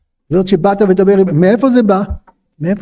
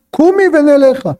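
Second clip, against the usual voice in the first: high, commanding order; curt command; 6 semitones higher than usual. Acted. Husband ordering collapsed concubine to rise, commanding. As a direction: order her up in a raised pitch. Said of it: "פקודה".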